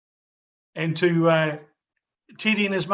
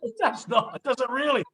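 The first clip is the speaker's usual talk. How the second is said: high-pitched "no" in disbelief; surprised disbelief; 10 semitones higher, 6 dB louder than usual. Real, not acted. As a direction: gasp out your shock at the heat. Disbelief at fifty degrees heat.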